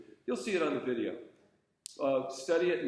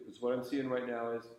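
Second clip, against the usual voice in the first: normal talking voice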